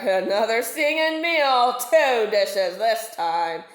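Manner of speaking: a shark licking his lips aloud; gloating shark. Acted. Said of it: "thought the shark".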